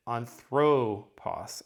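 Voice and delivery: "anthropos" with a drawn-out, stressed THRO; second-syllable stress. Shown as a demonstration. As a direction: slowly lean on the middle syllable of "anthropos". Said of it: "I would be emphasizing this".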